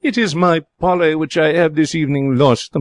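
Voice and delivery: high, delighted cry; joyfully relieved at finding her bird; with joy. Acted. Lady in dressing gown greets her lost parrot, joyful. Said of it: "with joy".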